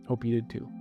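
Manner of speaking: usual speaking voice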